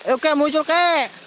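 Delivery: loud, high call into the dark. Real, not acted. Host summons an old ghost to appear, calling out loudly.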